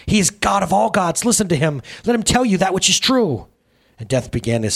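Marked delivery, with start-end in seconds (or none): 0.0-3.5 high, boastful proclamation; sickly proud. A narrator's reading. Sin presents Death as god of gods, gushing with pride. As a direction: brag on your son with raised pitch. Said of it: "so sickly proud of her son".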